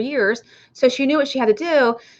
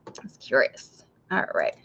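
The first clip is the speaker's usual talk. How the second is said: whisper; 11 semitones lower, 9 dB quieter than usual. Real, not acted. Whispered aside to viewers.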